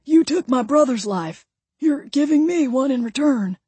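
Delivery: high-pitched, insistent plea; imploring. A narrator's reading. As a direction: press your claim on them. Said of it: "Ray implored".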